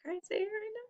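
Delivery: squeaky high tired-out voice; loopy crazy. Real, not acted. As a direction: sound a little fried from the long day. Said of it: "I'm a little bit".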